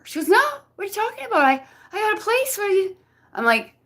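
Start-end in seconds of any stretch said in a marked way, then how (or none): 0.3-3.0 much higher, fast, confused denial; imitated friend. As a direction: rattle it off like a flustered friend.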